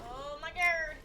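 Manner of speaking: startled shout. Real, not acted. Startled by a jump scare.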